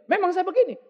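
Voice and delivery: "Memang saya begini" said high and loud, spoiling for a fight; a defiant, confrontational "Memang saya begini."; picking a fight. Retold or imitated. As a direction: snap it back like you want to start a quarrel. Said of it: "Udah kayak mau ngajak berantem".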